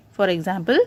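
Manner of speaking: usual speaking voice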